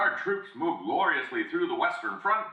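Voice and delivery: a hint of a wartime North Atlantic accent newsreader. Shown as a demonstration. Old radio announcer hailing troops, light North Atlantic accent. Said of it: "radio announcers would speak like this".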